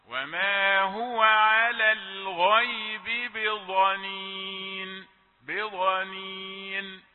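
slow tajwid chant with بضنين read with a soft ضاد; correct soft ḍād recitation. Shown as a demonstration. Teacher reciting the verse in the accepted reading. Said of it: "قراءتنا بالضاد الرخوة".

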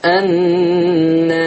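النار drawn out very long, alif tilted slightly toward e; تقليل الألف. Shown as a demonstration. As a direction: slowly chant النار, leaning the a toward e. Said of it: "ملت سنة بالألف". (النار بإمالة خفيفة في الألف، ممدودة)

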